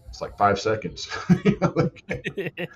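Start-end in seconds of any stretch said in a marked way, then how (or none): 1.3-2.7 high laughing aside; amused words squeezed through a laugh. Real, not acted. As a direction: break up laughing while talking. Laughing at coaches' short recovery demand.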